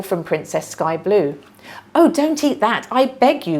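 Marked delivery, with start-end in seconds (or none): 1.9-3.6 higher and louder urgent plea; mock pleading cry. Minna in disguise begs Sky Blue to drop the pineapple, hammed-up alarm. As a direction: pretend alarm to trick her. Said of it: "cried Princess Minna".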